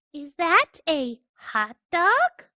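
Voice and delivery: high, slow little duckling voice asking sweetly; curious duckling. Acted. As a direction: ask wide-eyed like a tiny duckling. Duckling voice asks.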